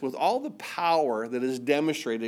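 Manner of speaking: ordinary talk, nothing marked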